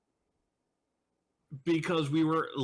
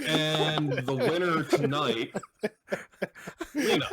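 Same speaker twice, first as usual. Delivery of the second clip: game-show announcer; slower than usual. Pretend. Crowning the chat viewer with the best question, game-show announcer. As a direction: announce the winner like a host.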